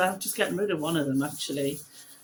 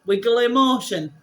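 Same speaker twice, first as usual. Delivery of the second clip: broad Yorkshire, louder and drawn out; Yorkshire accent; 5 semitones higher, 10 dB louder than usual. Retold or imitated. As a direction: ham up a Yorkshire vowel on wiggly motion. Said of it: "I went".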